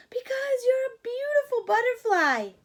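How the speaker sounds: high, loud pleading monkey voice; begging monkeys. Acted. Monkeys begging the butterfly to play, high loud plea. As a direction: beg like squeaky eager monkeys. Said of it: "they answered".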